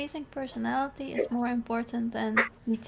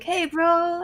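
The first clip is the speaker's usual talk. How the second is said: higher, louder friendly hail; Gabriel's positive greeting; 7 semitones higher, 6 dB louder than usual. Retold or imitated. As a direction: lightly imitate an upbeat buddy. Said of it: "always very positive".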